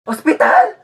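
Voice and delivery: high, startled question; mock-shocked yelp; alarmed. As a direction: blurt it out, forgetting to sound sick. Faker alarmed at hospital threat.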